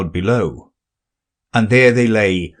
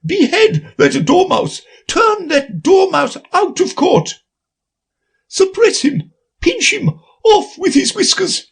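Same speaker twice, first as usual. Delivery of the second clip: queen shrieking. Acted. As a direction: scream the order at the court. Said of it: "the queen shrieked out".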